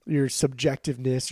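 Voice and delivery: normal talking voice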